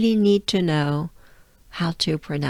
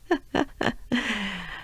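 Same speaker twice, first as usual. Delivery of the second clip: real short, soft laugh; amused chuckle; 3 semitones higher, 5 dB quieter than usual. Real, not acted. Teacher amused by funny sounds, amused chuckle. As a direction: chuckle softly at your own silly noises.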